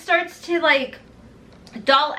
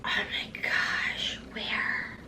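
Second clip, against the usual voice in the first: whisper; 12 dB quieter, much slower than usual. Real, not acted. Stunned by hidden sharpener.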